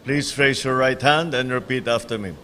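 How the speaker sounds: solemn, measured oath-taking; court oath. Acted. Administers the oath of office.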